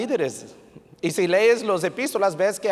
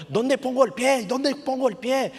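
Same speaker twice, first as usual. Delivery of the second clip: retold anxious plea, pitched up; imitated panicked climber; 6 semitones higher than usual. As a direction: act out a climber calling for help. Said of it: "le empieza a gritar a sus compañeros".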